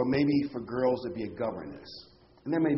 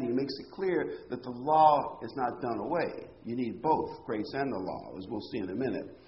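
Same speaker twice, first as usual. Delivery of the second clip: ordinary talk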